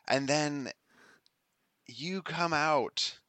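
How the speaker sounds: slow, loud, drawn-out exasperation; milk each word in dramatic disbelief; mock exasperation. GM mock-exasperated at a player's antics.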